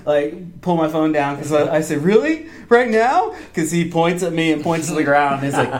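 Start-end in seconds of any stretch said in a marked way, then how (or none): 2.0-3.3 high, incredulous questions; retold disbelief. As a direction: act out being amazed at the offer. Retold disbelief at being called over.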